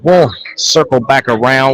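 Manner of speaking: ordinary talk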